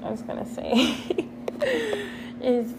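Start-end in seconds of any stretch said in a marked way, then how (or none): 1.0-2.4 quick high giggle at herself; giggling after nearly saying something rude; high embarrassed giggle. Real, not acted. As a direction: giggle high then drop. Laughs at a line she cut off.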